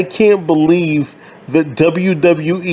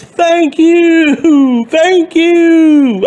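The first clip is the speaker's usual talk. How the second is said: much higher, louder mock crowd shout; mock wrestler yell; 9 semitones higher, 4 dB louder, much slower than usual. Fan mocking a wrestler yelling at the crowd, high and loud. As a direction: ham up a wrestler yelling thanks. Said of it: "he goes".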